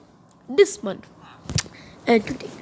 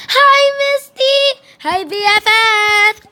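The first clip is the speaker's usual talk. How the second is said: excited greeting; 10 semitones higher, 6 dB louder than usual. Pretend. Student squealing hello to her best friend Misty. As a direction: sing out her name with delight.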